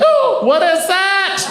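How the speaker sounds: high, slow, squeaky mouse alarm; be the mouse seeing the humans; startled mouse. Pretend. The mouse spotting them under the couch, squeaky and startled.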